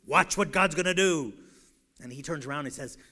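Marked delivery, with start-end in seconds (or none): 0.0-1.3 loud, grand prophet proclamation; booming mock-biblical command; Charlton Heston. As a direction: announce it like an epic film hero. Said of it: "in that Charlton Heston voice".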